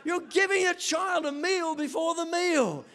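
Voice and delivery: high, hammed-up outcry; exasperated disbelief. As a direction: protest in mock outrage. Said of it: "and I'm thinking".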